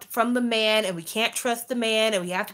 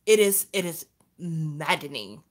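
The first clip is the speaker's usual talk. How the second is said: ordinary talk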